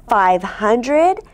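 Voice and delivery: ordinary delivery, nothing marked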